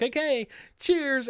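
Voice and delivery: high, drawn-out, breezy send-off; mock cheery sign-off. Critic mocking characters who just walk away, high cheery sign-off. Mocked characters brightly signing off.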